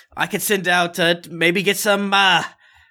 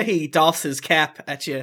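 normal talking voice

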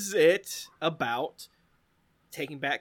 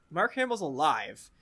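usual speaking voice

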